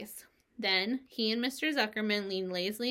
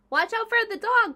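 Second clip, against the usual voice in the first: much higher, loud frantic cry; sheep crying out; 13 semitones higher, 9 dB louder than usual. A narrator's reading. Sheep warns Wilbur about the dog, loud shrill cry. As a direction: shriek a warning at the top of your voice. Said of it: "cried the sheep".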